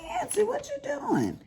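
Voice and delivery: high-pitched surprised call across the house; retold high-pitched call to the grandson. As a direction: call out high like you just caught him.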